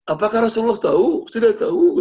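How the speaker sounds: high, loud anxious outburst; acted alarmed blurting. Tha'labah asking whether the Prophet knows, high-pitched alarm.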